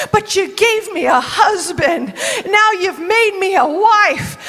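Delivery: laughing through tears; half-crying, half-laughing thanks; joyful tearful prayer. Acted. Ruth overjoyed at becoming a wife, laughing and teary. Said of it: "She's like".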